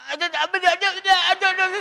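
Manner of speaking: high, hurried, repeating it helplessly; flustered mock voice. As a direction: stammer it like someone with no answer. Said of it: "Dia jawab". (suara panik tinggi, asal jawab)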